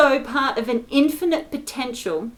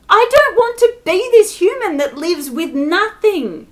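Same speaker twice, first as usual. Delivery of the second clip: louder, higher-pitched angry protest; furious rant; 5 semitones higher, 5 dB louder than usual. Real, not acted. Ranting about getting nothing on Christmas Day, furious. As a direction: rail against it at raised pitch and volume, for real.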